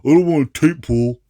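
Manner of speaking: mocking whiny collector. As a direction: sneer at the collectors in a silly whine. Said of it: "dumb shit collectors, like, think".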